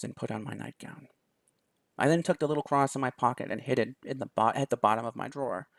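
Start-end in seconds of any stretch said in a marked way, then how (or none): none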